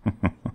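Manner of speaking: brief, condescending chuckle; soft mocking laugh; amused villain chuckle. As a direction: chuckle at being doubted. Demon amused at being disbelieved.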